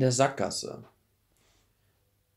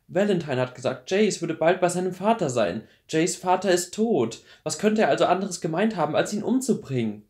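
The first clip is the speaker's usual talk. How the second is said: urgent argument; 7 semitones higher, 5 dB louder than usual. Acted. Clary argues Jace's danger.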